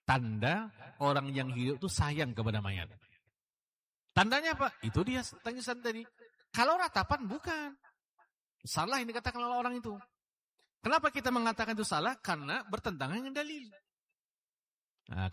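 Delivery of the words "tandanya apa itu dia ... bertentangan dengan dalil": higher, fast and forceful sermon voice; impassioned preacher pressing the congregation; fiery preaching. Acted. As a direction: drive the point home from the pulpit.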